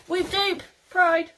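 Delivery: loud, slow, high celebratory call; excited cheer. Real, not acted. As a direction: proclaim it like a party cheer. Cheerful Pride Month announcement.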